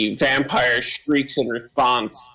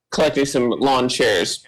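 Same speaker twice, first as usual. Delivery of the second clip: ordinary talk, nothing marked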